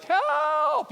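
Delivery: high, loud cry of "Help!"; panicked call into the dark woods; shouted for help. Retold or imitated. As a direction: yell it like a lost kid in the woods. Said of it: "to be like".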